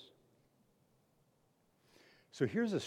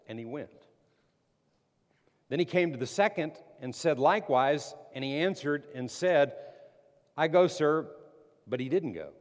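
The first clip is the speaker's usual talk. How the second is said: loud, raised preaching read; forceful scripture reading; 4 semitones higher, 8 dB louder than usual. Acted. Preacher reading Matthew to the church, loud and proclaiming. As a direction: proclaim the parable to the room. Reads parable at full force.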